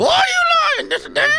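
squeaky shouting dummy; high puppet shout. Pretend. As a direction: yell it in a tiny high voice.

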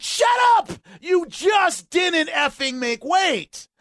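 yelled high and loud, dragging the last line slowly; furious shout. Real, not acted. Podcaster ranting at a fighter's self-help excuse, furious shout. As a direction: blow up at the excuse, really fed up. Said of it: "I get furious reading that kind of stuff".

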